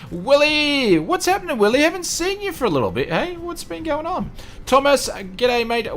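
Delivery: light up at a familiar name; excited greeting. Real, not acted. Greeting a returning regular warmly.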